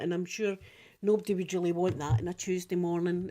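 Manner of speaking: normal talking voice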